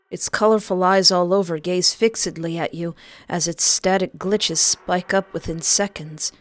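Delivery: ordinary delivery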